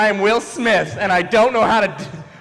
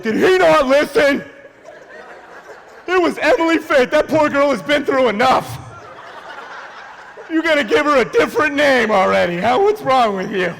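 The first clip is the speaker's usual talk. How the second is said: higher-pitched imitated scolding; mock indignation; 7 semitones higher than usual. Audience members scold the comic for not listening, mock indignant. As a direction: play an outraged crowd telling someone off.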